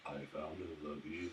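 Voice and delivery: low, soft copied deep voice; deep voice imitation. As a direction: mimic his deep voice. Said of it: "If you're gonna speak in that voice".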